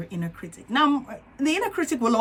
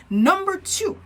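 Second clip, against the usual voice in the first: ordinary talk, nothing marked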